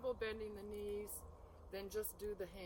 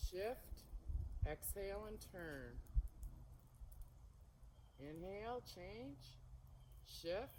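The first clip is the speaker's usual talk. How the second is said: serene tai chi cues; 5 dB quieter than usual. Shown as a demonstration. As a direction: call each move gently, unhurried. Leads the flowing form.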